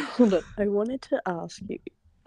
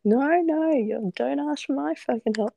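higher, mock furious refusal; imitating an angry outburst; 7 semitones higher than usual. Retelling how he lost his temper during the move, a mock furious pitched-up refusal. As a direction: play him losing it, pitched up and indignant. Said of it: "lost his shit ... and was like".

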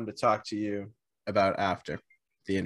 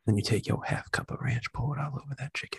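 breathy seductive whisper; sultry whisper. Acted.

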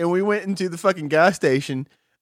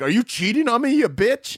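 higher-pitched jealous outburst, played for laughs; mock accusation; 6 semitones higher than usual. Boyfriend to girlfriend back in the car, mock-accusing.

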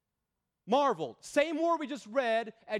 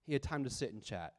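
lower, much softer echo of the point; soft repeat; 7 semitones lower, 8 dB quieter than usual. Real, not acted. Preacher quietly repeating his point to the congregation, soft and low. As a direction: let it drop, quiet and low.

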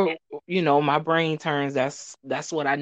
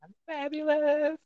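diva voice; 11 semitones higher, 3 dB quieter than usual. Pretend. Guest answers in put-on voice.

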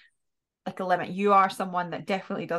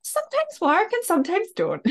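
high sing-song mock summary; 13 semitones higher, 5 dB louder than usual. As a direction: toss it off in a high, silly voice. Jokey one-line summary of advice.

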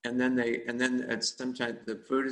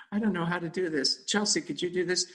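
flustered; 6 semitones higher than usual. Retold or imitated. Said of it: "she got flustered".

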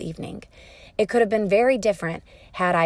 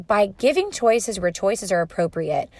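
ordinary talk, nothing marked

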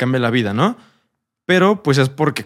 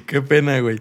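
laughing apologetically; sheepish laugh after burping; embarrassed laugh. Real, not acted. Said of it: "perdón, estoy tomando cerveza". (riendo con pena)

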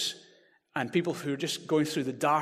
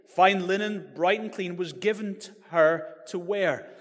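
exultant scripture reading; 3 semitones higher, 5 dB louder than usual. Acted. Preacher reading the great multitude's song, loud and exultant. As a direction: read it like a triumphant herald.

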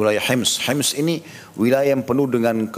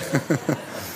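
soft laugh; 2 semitones higher, 6 dB quieter than usual. Real, not acted. Tertawa lagi.

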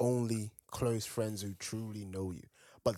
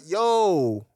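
higher, louder shocked yo; 4 semitones higher, 4 dB louder, much slower than usual. Retold or imitated. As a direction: react like you can't believe it. Retold own shocked reaction.

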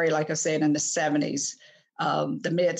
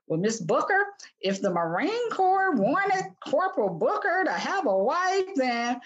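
a hammed-up country sergeant brushing off a caller in a Southern accent; mocking country accent; 8 semitones higher than usual. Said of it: "this real country accent".

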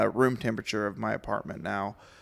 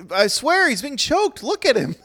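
mock Paul voice; 13 semitones higher, 5 dB louder than usual. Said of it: "he's like".

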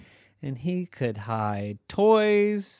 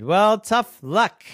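louder, slow no-nonsense verdict; firm and final; 9 semitones higher, 6 dB louder, slower than usual. Acted. Mommy telling the lazy boy, firm and slow. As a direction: lay down the law.

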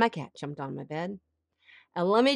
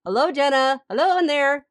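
higher, teasing call of hello; knock-knock wake-up call, mock and raised; God knocking on her head; 6 semitones higher, 4 dB louder than usual. God trying to get through to a burnt-out woman, mock call-out. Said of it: "knocked on my head and said".

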